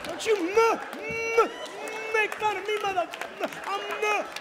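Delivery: very high angry stutter; stuttering driver. Acted. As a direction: stammer defensively. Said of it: "if you had a stutter".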